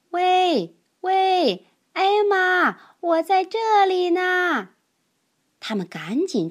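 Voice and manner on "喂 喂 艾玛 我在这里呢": high, loud call from somewhere hidden; hollering so Elmer can find him; calling out from afar. Acted. Hidden cousin Webb calls out to Elmer, loud high call.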